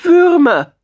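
very high, loud, thin mole call; thin-voiced mole. A narrator's reading. Mole calls out what he wants, thin high squeaky voice. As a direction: shout it in a tiny squeaky voice. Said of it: "mit seiner dünnen Stimme".

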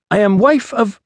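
high-voiced Iceni-accented declaration, cut off; foreign Iceni accent. A narrator's reading. Iceni woman introducing herself to the official, foreign accent. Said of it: "trouble following your accent".

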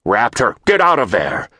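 big booming command. A narrator's reading. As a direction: boom it out like a general. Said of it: "boomed the voice of General Lance Burnett".

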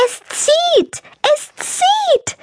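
very high shrill horrified shriek; shrieking hens. A narrator's reading. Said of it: "schrien Gacka und Glucka entsetzt". (entsetztes, sehr hohes Hühnerkreischen)

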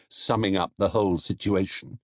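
plain reading voice

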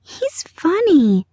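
put-on high, drawn-out little girl voice; sweet little voice. A narrator's reading. Sister watching the floating fish, sweet little voice. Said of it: "in her sweet little voice".

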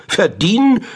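high, loud outraged cry; outraged shriek. A narrator's reading. Great-grandmother shrieks back at great-grandfather, outraged. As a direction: toss the word back in disbelief. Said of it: "schrie meine Obergroßmutter".

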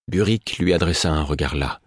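ordinary delivery

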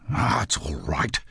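low, grudging brush-off; gruff. Acted. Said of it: "he said gruffly".

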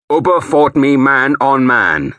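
loud, slow, angry declaration; too loud. A narrator's reading. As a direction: force each word out too loudly. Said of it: "too loudly now".

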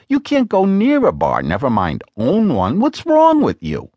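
a hint of an exasperated wife pressing her husband; Lena's voice. A narrator's reading.

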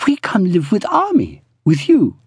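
put-on high, accented woman's voice; voiced Pearl. A narrator's reading. Pearl proposing to move in, high voice. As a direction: pitch it up as a playful proposal.

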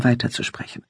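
ordinary delivery, nothing marked

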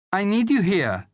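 high, urgent woman's voice; pleading mother. A narrator's reading. Mother needing son at home, pleading. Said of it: "said Juliana".

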